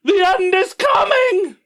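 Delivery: high, slow wailing cry of doom; alarmist doom warning. Acted. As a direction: shout the end is coming. Doom cry after prophecy.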